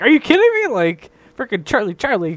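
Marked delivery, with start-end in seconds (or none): 0.0-0.7 disbelief. Retold or imitated. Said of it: "And I'm like".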